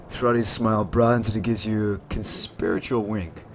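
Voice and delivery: normal talking voice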